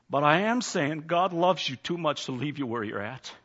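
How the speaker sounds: ordinary delivery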